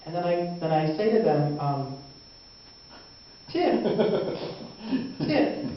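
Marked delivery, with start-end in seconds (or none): 3.5-5.6 high, clipped demand for a tip; hint at the tip, voice jumping up high; pointed tip demand. Acted.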